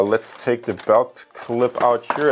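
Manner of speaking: ordinary delivery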